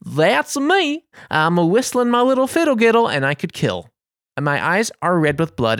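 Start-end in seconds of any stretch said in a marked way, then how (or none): 0.0-3.1 high, silly sing-song voice; mock-jolly, much higher pitch; pan flute kid. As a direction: pipe up like a prancing little flute player. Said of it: "clicking his heels together and whistling like this little flute".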